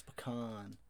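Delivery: PECAN said "puh-KAHN", stressed ah; pecan with an open second vowel. Shown as a demonstration. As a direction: rhyme the end of pecan with "lawn". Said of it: "It depends on how you say".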